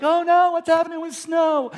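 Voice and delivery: high, loud fretting like an over-invested fan; mock panic. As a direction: play up the alarm over a TV drama. Mimics himself hooked on TV show.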